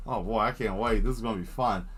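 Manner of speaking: usual speaking voice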